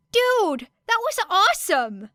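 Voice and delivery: high, loud excited student voice; an awestruck kid yelling; excited shout. A narrator's reading. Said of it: "shouted one of the other hero students".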